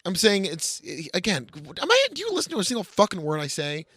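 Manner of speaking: ordinary talk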